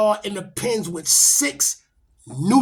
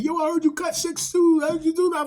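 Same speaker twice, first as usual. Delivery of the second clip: loud and much higher, an amazed retold shout; excited question; 10 semitones higher, 4 dB louder than usual. Inmates hyped he cut six, loud excited shout. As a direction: burst out hyped at the news.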